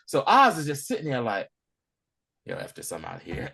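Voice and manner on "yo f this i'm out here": low, much softer aside, done with it; muttered exit. Retold or imitated. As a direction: say it under your breath, lower. Oz decides to flee.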